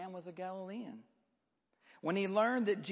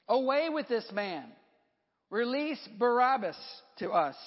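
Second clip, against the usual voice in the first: loud, higher-pitched demand; crowd shout; 6 semitones higher, 5 dB louder than usual. A narrator's reading. Crowd demanding Barabbas from Pilate, shouted. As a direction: shout it out as an angry mob would. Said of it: "with one voice they shouted".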